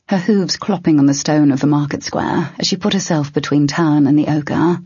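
ordinary delivery

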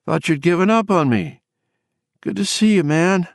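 high, wavering, exhausted greeting; Bobby. A narrator's reading. Exhausted woman greeting her old friend, high and wavering. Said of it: "hear the waver in her voice again".

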